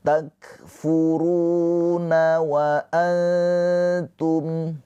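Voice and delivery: Takfuruna wa antum slow, the nun of antum hidden and nasal; nun sukun dibaca samar; ikhfa recitation. Shown as a demonstration. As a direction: chant it slowly, veiling the nun. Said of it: "nun sukun bertemu dengan huruf ta".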